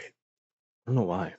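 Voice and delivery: ordinary talk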